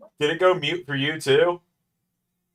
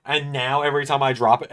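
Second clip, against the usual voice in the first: ordinary delivery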